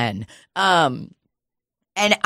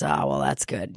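lower, softer imitation of Rick; mock Rick, deflated; 13 semitones lower, 9 dB quieter than usual. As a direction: let Rick's reply sink low and quiet. Rick voiced low and soft.

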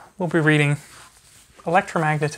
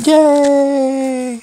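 put-on high, loud cheer for a toddler; big happy yay; 11 semitones higher, 10 dB louder than usual. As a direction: celebrate the ending like a kid's party. Cheers at end of book.

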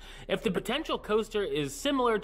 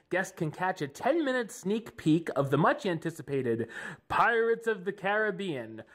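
ordinary talk, nothing marked

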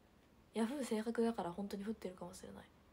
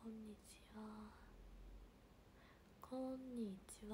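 slow YouTuber greeting; 10 dB quieter, slower than usual. Shown as a demonstration. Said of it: "なんかのユーチューバーさんの挨拶みたい".

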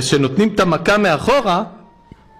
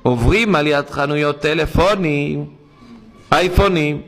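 usual speaking voice

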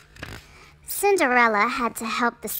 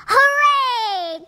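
squeaky high, loud cheer; cheering mice; 12 semitones higher, 6 dB louder than usual. A narrator's reading. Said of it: "cried the mice".

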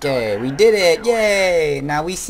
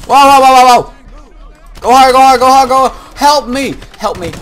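high, loud frantic shouts; panicked yelling; 11 semitones higher, 11 dB louder than usual. Real, not acted. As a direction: scream it in real panic.

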